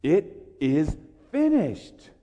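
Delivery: slow, drawn-out and weighty; solemn last words. Acted. Preacher voicing Jesus's last words from the cross, slow and weighty. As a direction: let each word land slowly and heavily.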